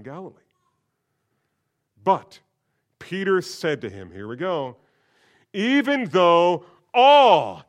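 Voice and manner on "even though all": slow, much louder, higher "all"; drawn-out boastful "Even though all"; stress on all. Acted. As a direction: throw "all" back proudly. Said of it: "he's using Jesus' word".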